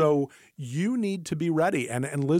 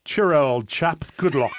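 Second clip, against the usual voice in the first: mock English accent; 5 dB louder than usual. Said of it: "my best English accent".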